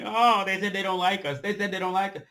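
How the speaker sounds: mock whining. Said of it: "Always whining".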